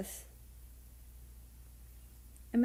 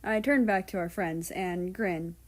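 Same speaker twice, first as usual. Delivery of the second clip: plain reading voice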